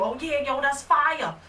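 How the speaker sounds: normal talking voice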